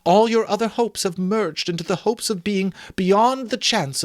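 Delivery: put-on Belle, earnest young woman. Belle confronting Scrooge about his love of gain, earnest.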